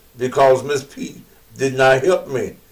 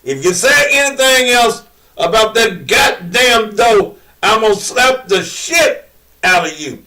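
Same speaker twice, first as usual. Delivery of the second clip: angry threat; 6 semitones higher than usual. Retold or imitated. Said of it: "she repeated it again".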